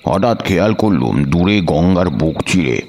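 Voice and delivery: ordinary delivery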